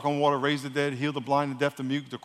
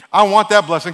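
loud, quick and greedy: "I want that blessing"; fast first telling; 4 semitones higher, 9 dB louder than usual. Acted. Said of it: "I know I talk fast".

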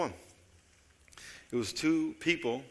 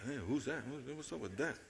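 hushed puzzled aside; 11 dB quieter than usual. Acted. Bystander voiced, puzzled, hushed.

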